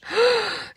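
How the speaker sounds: sharp high excited gasp. Real, not acted. Crafter imagining a viewer earning the trip, gasping. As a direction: gasp at the thought.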